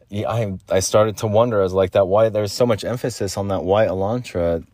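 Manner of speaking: usual speaking voice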